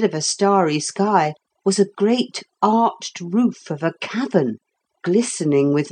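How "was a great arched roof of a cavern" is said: ordinary delivery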